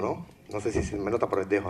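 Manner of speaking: ordinary delivery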